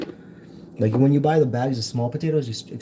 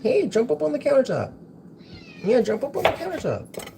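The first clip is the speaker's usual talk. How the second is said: much higher coaxing pet voice; pet talk. Real, not acted. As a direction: coax the cat up in a high sing-song. Coaxing the cat onto counter.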